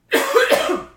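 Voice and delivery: real choking cough on food; sudden cough from swallowing wrong. Real, not acted.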